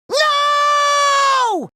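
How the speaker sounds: very high, long cartoon "no"; dismayed wail. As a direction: stretch it into a high held cry. Dismayed outburst.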